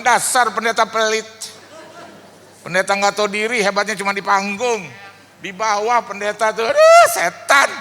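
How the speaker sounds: busker's imagined rant at a stingy pastor, shouting; imagined insult. Retold or imitated. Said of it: "apa dia ngomong".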